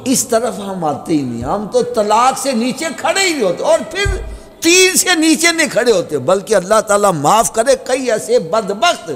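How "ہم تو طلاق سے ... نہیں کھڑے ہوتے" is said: high-pitched rebuke from the pulpit; impassioned sermon rebuke; fiery preaching. Acted. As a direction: scold the listeners.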